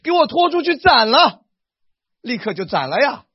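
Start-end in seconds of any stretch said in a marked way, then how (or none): 0.0-1.4 loud, high imperial order; booming imperial decree; emperor's command. Retold or imitated. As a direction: bark it like an emperor sentencing a man. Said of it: "皇帝怎么说话？".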